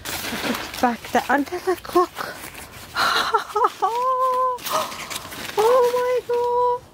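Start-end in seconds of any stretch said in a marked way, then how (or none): 2.9-5.5 high, long delighted laugh; overjoyed laugh. Real, not acted. Looking again at the gift, overjoyed laugh. 5.6-6.8 high squealed wonder; overjoyed disbelief. Real, not acted. Looking again at the pots, overjoyed squeal.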